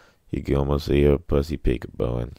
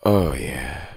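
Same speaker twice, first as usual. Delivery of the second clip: breathy exhale. Real, not acted. Exhaling after a smoke.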